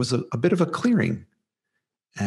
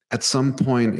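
ordinary talk, nothing marked

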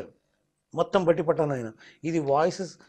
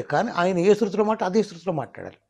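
ordinary talk

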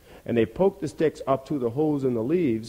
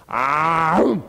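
rising open-mouthed snap; imitated chomp; 3 semitones higher, 4 dB louder than usual. Dog lunging at the rabbit's tail, imitated chomp. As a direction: open wide on the vowel and bite it shut.

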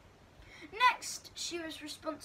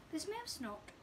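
ordinary delivery, nothing marked

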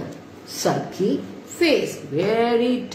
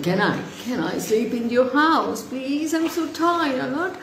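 high, wheedling plea; pleading tired girl; 6 semitones higher than usual. Acted. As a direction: beg for a bed, pitched up. Girl begs fairy for shelter.

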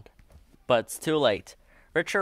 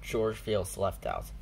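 plain reading voice

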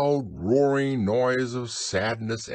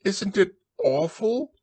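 high, fearful little voice; scared Piglet; 11 semitones higher than usual. A narrator's reading. Piglet shows Christopher Robin the monster, high and fearful. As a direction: squeak it in dread. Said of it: "said Piglet".

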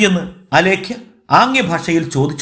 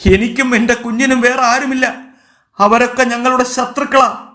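high, distraught lament; acted tearful pleading, pitched up; crying outcry; 8 semitones higher than usual. A narrator's reading. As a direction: cry out as a desperate mother. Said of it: "ഒച്ചയും കരച്ചിലും കേട്ട്".

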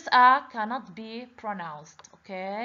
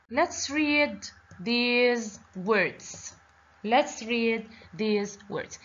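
slow read-along prompt; much slower than usual. Shown as a demonstration.

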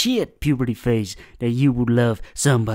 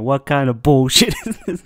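ordinary talk

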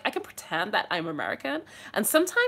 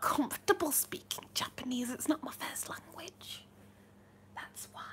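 whispered confession, fading softer; 4 semitones higher, 10 dB quieter than usual. Real, not acted. Streamer explaining to chat why she avoids Japanese, whispering. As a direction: let it out in a sheepish whisper.

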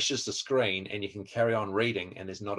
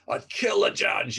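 much higher, slow mock outrage; animated outrage; 11 semitones higher, 5 dB louder, slower than usual. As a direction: imitate an outraged listener, hammed up. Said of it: "they get really animated".

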